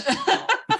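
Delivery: burst of laughter. Real, not acted. Laughing at own remark.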